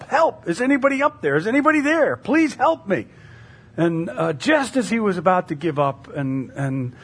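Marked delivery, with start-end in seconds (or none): 0.0-3.1 high-pitched, acted cries for help; calling for help. Hiker hanging from a branch to anyone above, calling for help. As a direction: call up desperately, as in a told story. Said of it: "started calling out for help".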